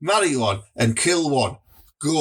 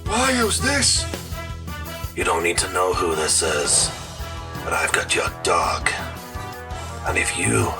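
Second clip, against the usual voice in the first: low, hushed blackmail voice; menacing caller; 11 semitones lower than usual. Acted. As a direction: threaten low and close. Threatening phone caller.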